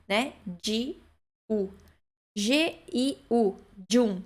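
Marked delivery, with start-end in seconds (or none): 3.9-4.2 "Giun" short, said djun; Italian soft g. Shown as a demonstration. Teacher sums up G-I-U, careful pronunciation. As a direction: put a d before the j in "Giun". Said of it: "a gente pronuncia giun".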